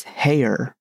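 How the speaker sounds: "Hare" said to rhyme with mayor; rhyming with mayor. A narrator's reading. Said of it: "pronounced it so as to rhyme with mayor".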